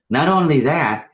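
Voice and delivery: ordinary delivery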